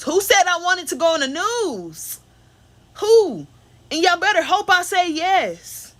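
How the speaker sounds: outraged who-said-that protest; indignant outburst. Real, not acted. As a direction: fire back in disbelief. Indignant about going on the news.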